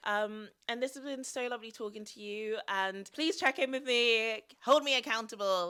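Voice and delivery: normal talking voice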